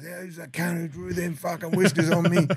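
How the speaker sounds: loud, high yell across the jail; calling out. Retold or imitated. Inmate yelling out who drew whiskers on him, loud. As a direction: bellow it out to the whole wing. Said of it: "he calls out the master".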